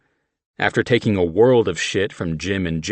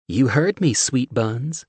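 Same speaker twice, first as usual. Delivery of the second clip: higher flirty Southern woman; sultry Southern; 6 semitones higher, slower than usual. A narrator's reading. Steph flirting with John, Southern accent.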